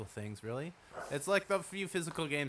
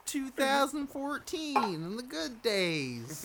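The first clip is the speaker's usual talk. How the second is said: high mock reminiscing; 12 semitones higher, slower than usual. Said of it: "I was like".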